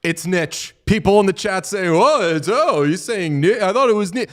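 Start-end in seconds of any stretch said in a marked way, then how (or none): 0.0-0.8 "niche" rhyming with "pitch"; pronounced "nitch". Shown as a demonstration. Correcting chat on the word, "niche" said as "nitch". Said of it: "that word is pronounced niche". 1.9-4.2 higher-pitched, whiny mimicry of objectors; mock their protest in a higher, wavering voice; mocking chat quote. Imitating chat disputing a pronunciation, mocking.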